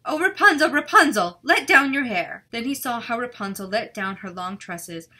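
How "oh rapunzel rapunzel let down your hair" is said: loud, high witch call up to the tower. A narrator's reading. Witch calls up to Rapunzel in the tower, loud and high. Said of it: "listened while she called out".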